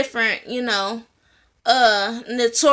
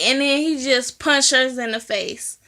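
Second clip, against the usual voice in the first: usual speaking voice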